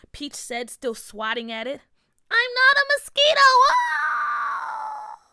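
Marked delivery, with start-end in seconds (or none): 2.3-3.5 shrill little fairy yelling high; high, loud and offended; indignant fairy shout. A narrator's reading. Fairy queen shouts back. 3.7-5.2 fairy shriek. A narrator's reading. Fairy cries out.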